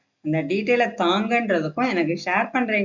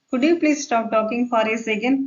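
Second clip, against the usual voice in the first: slow, higher polite question; polite request; 4 semitones higher, much slower than usual. Shown as a demonstration. Teacher rewords "stop talking" for learners, polite request. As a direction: ask courteously, rising at the end. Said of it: "இந்த மாதிரி பேசி பாருங்க".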